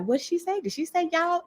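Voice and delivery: high-pitched baffled question; puzzled listener. Acted. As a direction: imitate a listener puzzled by the accent. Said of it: "You're like".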